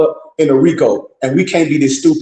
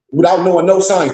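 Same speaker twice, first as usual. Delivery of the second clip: usual speaking voice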